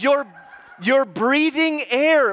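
high, loud, slow exclamation; over-the-top emphatic retelling; retold near-yell. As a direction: stretch it out as an exaggerated proclamation. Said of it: "I didn't yell 'cause my".

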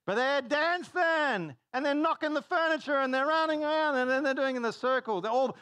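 high, loud, breathless-paced rush of excitement; excited uproar. Retold or imitated. Preacher describing the dancing and knocked furniture, in an excited shout. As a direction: shout the chaos of a wild party. Excitedly pictures the wild party.

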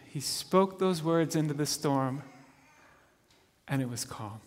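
usual speaking voice

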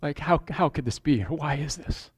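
quiet, breaking, tearful prayer; hushed grieving cry to God; tearful plea. Retold or imitated. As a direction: plead softly as if near tears. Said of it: "I'm weeping and crying".

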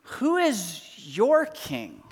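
who is your king drawn out slowly, stress on is; slow, deliberate question. Acted. Pastor asks the congregation slowly and weightily. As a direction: let it land slowly and heavily. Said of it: "a more personal question for us to ask is".

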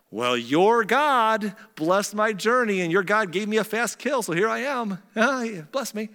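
high, hammed-up cover story with a laugh; bluffing son. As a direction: sell the lie to Dad, pitched high. Jacob bluffs about his quick hunt.

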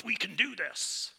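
high, slow rah-rah cheer; hammed-up pep rally voice; pumped-up cheer. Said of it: "rah, rah, rah, pumped".

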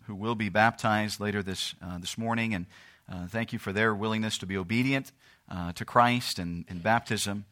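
low, soft, humble prayer. Real, not acted. Pastor asking God to bless the service, hushed prayer. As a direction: pray aloud gently, voice dropping toward the amen.

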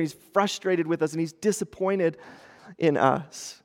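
usual speaking voice

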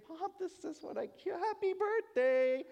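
put-on high, squeaky, eager child voice; a small child thrilled to hand over a gift; excited little kid. Kid hands mom a birthday present, high eager child voice.

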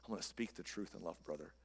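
hushed pious mockery of a stock phrase; soft, syrupy church voice; churchy cliché.

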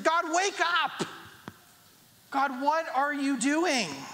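high, drawn-out wake-up call; urgent plea to God. Acted. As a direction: call out to rouse God, stretching the words. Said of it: "It's saying".

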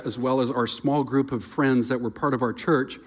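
usual speaking voice

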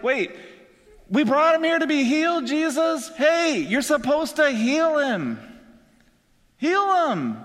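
high-pitched, insistent protest; indignant friends. Pretend. Friends on the roof telling Jesus to heal him, indignant.

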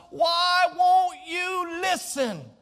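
loud, slow, drawn-out cry; God crying out to a stubborn Cain; slow exasperated shout. Acted. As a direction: stretch each word out in despair. God's plea rises to shout.

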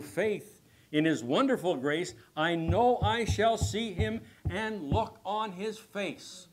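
loud hymn verse, preached; reciting a hymn. Acted. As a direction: declaim each hymn line with fervor. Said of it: "someone penned these words".

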